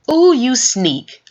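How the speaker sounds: ordinary delivery, nothing marked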